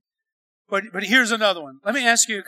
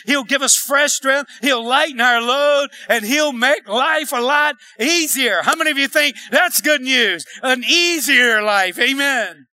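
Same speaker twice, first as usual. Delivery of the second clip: loud, emphatic pulpit delivery; forceful preaching; 3 semitones higher, 5 dB louder than usual. Real, not acted. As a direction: hammer the promise home, stretching key words. Preaches promise at full force.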